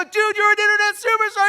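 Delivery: very high and loud, triumphant; overjoyed praise. Retold or imitated. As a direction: hype him up.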